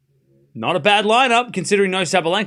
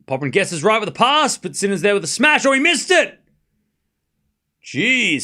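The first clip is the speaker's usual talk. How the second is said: live play-by-play; 4 semitones higher than usual. Real, not acted. Commentator calls Sinner's missed smash, excited live play-by-play. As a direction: follow the rally, then burst out at the miss.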